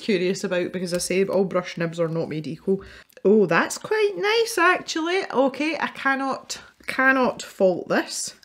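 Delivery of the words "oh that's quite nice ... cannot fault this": high, slow impressed delight; genuinely impressed, drawn out; pleasantly surprised. Real, not acted. Impressed by brush pen.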